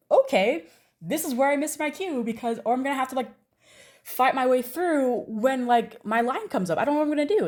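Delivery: fretting to herself that the cue is lost; panicked inner voice. Retold or imitated.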